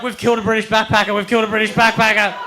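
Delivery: fast, high, loud fretting; panicked. Acted. Jules to his friend on the beach, fast panicked repeating. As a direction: rattle it out in a rising panic. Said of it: "We're freaking out".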